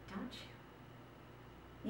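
breathy whispered plea; softly whispered "don't you?". Acted. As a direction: barely voice it, hoping. Pleading question to the man.

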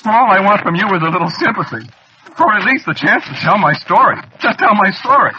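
high, loud, aggrieved plea; worked-up, desperate appeal; pleading outburst. Acted. Pleads for sympathy from listener.

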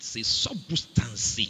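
"substance" as sɔbustans in a Central African accent, drawn out. Shown as a demonstration. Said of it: "You can say it with a Central African accent".

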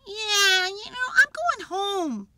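loud, high character voice; high, emphatic exit line; fed-up announcement. A narrator's reading. Jim gives up on the party.

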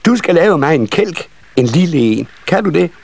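put-on high, eager child voice; eldest nisse child. A narrator's reading. Eldest child ordering a sled from carpenter, high and eager. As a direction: request it in a high little voice.

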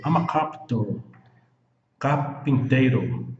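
ordinary talk, nothing marked

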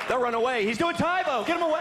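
high, frantic mock panic; hammed-up alarm. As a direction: cry out as a scared bystander. Panicked bystanders fleeing.

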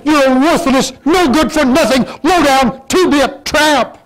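high, loud contemptuous tirade; hammed-up scornful outburst at a brother; mock insult. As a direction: spit the insult at someone, slowing on two-bit tramp. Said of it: "may not have said it quite like that".

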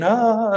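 a sudden high, loud mock gasp of alarm; mock alarmed cry. Mentor mimicking the world reacting to problems, with a loud high mock alarmed cry. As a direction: yelp like someone rushing to fix a mess.

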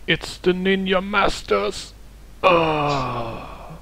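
dying gasp, high and slow; overacted death scene. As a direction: cry it out with a last breath, hammed up. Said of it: "dying dramatically".

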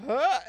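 big gasp into a high "huh"; mock shocked gasp. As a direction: gasp in exaggerated disbelief. Duncan's instant shock, retold.

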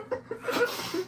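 high unvoiced burst of laughter; high breathy laugh. Real, not acted. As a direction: let a high giggle escape. Laughs at own remark.

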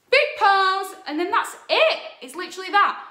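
loud high burst of energy; excited. Real, not acted. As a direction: shout it out triumphantly. Finishing the dance with big pose.

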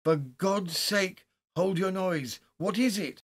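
high, urgent, exasperated demand; loud cry. A narrator's reading. Friend telling screaming Haldane to hold his noise, loud and urgent. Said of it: "hardly less loud".

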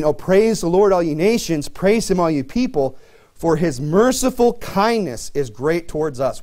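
slow, solemn reading of the verse; measured scripture reading from the pulpit. Acted. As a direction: read it out like holy writ, slow. Reads scripture aloud.

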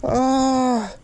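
high, pained lament; real dismay. Real, not acted. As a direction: wail lightly over a snapped line. Line snaps, hook is lost.

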